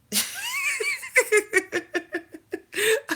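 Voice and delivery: bursts into a high-pitched giggle; real high laugh. Real, not acted. Finds setting, laughs at herself.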